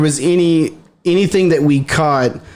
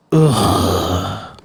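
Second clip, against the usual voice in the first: bigfoot grunting; 8 semitones lower than usual. Shown as a demonstration. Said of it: "Like that".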